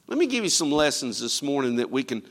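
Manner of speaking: usual speaking voice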